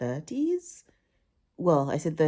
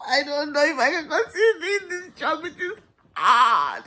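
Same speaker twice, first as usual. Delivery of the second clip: hammed-up tearful complaint; mock wailing; 9 semitones higher than usual. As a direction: whine it like you're about to bawl. Mock-tearful complaint about the job.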